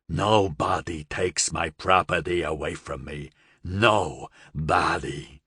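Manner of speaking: low, menacing gangster voice; seething gangster. A narrator's reading. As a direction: lay down the law slowly, seething.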